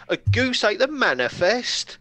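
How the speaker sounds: loud, slow, high disbelief; incredulous. Acted. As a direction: repeat it as if you can't believe it. Incredulous echo.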